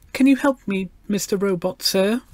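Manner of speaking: put-on high, polite little girl; treble child voice. A narrator's reading. Gloria asks the talking robot for help, polite treble. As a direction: ask it in a high, respectful child's voice. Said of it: "she raised her treble voice".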